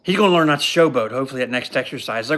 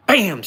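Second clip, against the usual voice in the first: sound effect bam; 6 semitones higher, 5 dB louder than usual. Retold or imitated.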